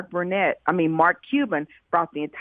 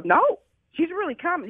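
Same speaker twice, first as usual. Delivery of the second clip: retold protest, pitched much higher; insistent; 12 semitones higher than usual. Founder tells a laughing friend Oprah is coming, insistent. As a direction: insist in a high, emphatic voice.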